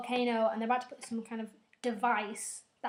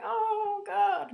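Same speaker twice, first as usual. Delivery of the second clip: much higher, louder despairing cry; anguished cry; 9 semitones higher, 5 dB louder than usual. Retold or imitated. Grieving father outside hospital, anguished cry. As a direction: cry out in grief. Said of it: "he's like".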